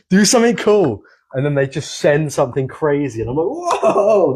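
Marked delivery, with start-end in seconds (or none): 0.1-1.0 higher-pitched mock heckle; heckling yell. Chirping at riders below the chairlift, raised mock yell. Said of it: "when you yell at someone". 3.5-4.3 high, drawn-out mock cheer; amazed cheer. Cheering riders from the chairlift, high retold whoop.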